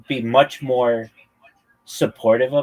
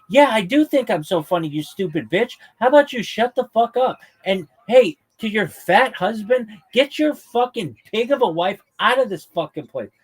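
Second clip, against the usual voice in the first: much higher, louder hammed-up rant at a heckler; comic blowing up at a heckler, mock rage; mock furious comeback; 8 semitones higher, 3 dB louder than usual. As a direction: tear into the heckler from zero to ten.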